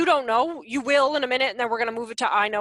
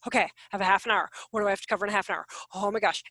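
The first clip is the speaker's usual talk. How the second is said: rushed inner voice; 4 semitones lower, 4 dB quieter, much faster than usual. Retold or imitated. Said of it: "I'm like".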